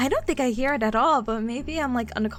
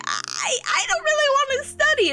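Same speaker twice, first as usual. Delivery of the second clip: whiny protest; 3 dB louder than usual. Real, not acted. Streamer admitting reluctance to viewers, high playful whine. Whines about having to study.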